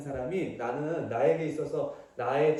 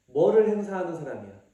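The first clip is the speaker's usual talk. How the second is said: ordinary talk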